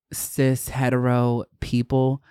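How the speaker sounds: normal talking voice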